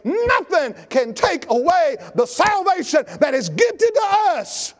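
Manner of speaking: high, forceful pulpit shout; preaching at full force. Acted. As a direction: drive each point home to the congregation.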